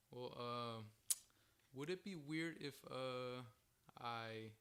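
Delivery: halting, with long pauses between words; hesitant. Retold or imitated. Skater mimics homeless man's awkward request, hesitant. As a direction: imitate someone reluctant to spit it out. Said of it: "hesitant, like, he doesn't wanna spit it out".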